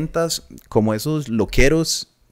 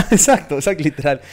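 laughing, higher and louder exacto; 4 semitones higher, 5 dB louder than usual. Real, not acted. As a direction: burst out laughing as you agree. Laughing agreement with friend.

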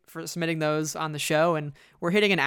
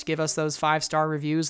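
ordinary delivery

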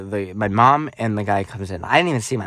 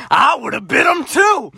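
proud mom; 14 semitones higher, 3 dB louder, slower than usual. Retold or imitated. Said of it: "My mom's like".

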